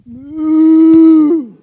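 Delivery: long, loud drawn-out moo; cow moo. Shown as a demonstration. Leading the audience in being cows, loud moo. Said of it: "Now we're gonna be cows".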